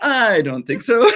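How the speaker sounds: slow, high laughing refusal. Real, not acted.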